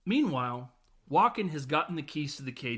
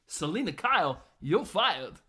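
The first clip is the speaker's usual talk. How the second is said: higher, louder swooping impression; mock dismissal; 4 semitones higher, 5 dB louder than usual. Boss firing Selina Kyle, loud swooping impression. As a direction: punch out the dismissal in an imitated voice. Walken's line acted out.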